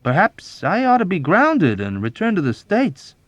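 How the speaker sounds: high, hopeful slyness; sly suggestion. A narrator's reading. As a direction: slip it in craftily. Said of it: "he suggested slyly".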